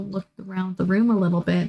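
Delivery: ordinary talk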